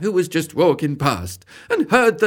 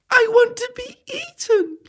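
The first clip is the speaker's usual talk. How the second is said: much higher, crying voice; tearful plea. Acted. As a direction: plead through tears. Crying apple pleads.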